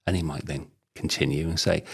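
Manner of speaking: ordinary talk, nothing marked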